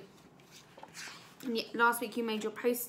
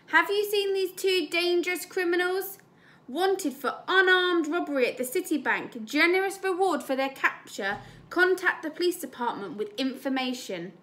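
higher, announcing voice of a wanted poster; poster read-aloud; 7 semitones higher, 4 dB louder than usual. Acted. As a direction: proclaim it like a public notice.

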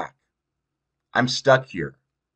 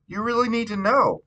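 much higher, wary mother's question; Mom's voice; 12 semitones higher than usual. Acted. Wary Mom asking why her daughter wants to know, in a higher voice. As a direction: play the mother, guarded about her past. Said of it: "Mom asks".

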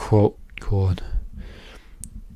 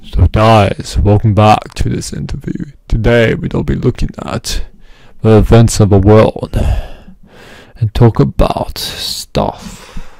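mock news host; 8 dB louder than usual. Mock news show opening.

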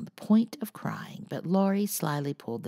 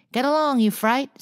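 much higher, louder parrot screech; screaming parrot; 11 semitones higher, 8 dB louder than usual. A narrator's reading. Parrot screaming rudely at Amy. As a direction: screech the insult like a rude bird. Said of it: "screamed Polly".

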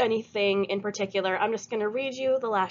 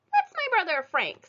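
Stella's voice; 11 semitones higher than usual. A narrator's reading. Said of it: "said Stella to Leroy".

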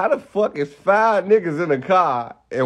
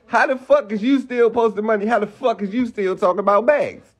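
ordinary talk

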